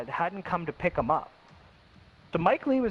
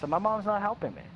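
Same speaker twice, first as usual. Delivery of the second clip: usual speaking voice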